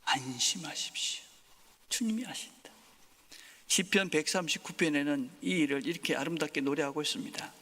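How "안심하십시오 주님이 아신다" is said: hushed reassurance. Acted. Pastor whispering reassurance to the congregation, hushed. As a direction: lean in and whisper it gently.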